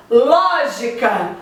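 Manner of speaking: Lógica said slowly with a long, strong ló; slow teacher's demo of Lógica, leaning on ló; stress on LÓ. Shown as a demonstration. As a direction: stretch the first syllable ló of Lógica. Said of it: "Ló é a sílaba tônica".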